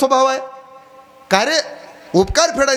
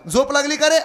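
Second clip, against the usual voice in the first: put-on old mother calling; 7 semitones higher than usual. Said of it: "परत म्हातारीनं आवाज दिला".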